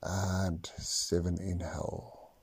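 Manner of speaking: slow counting, whispered on exhales; slow breath counting. Acted. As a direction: count each breath calmly, long pauses. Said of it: "actually count your breaths now".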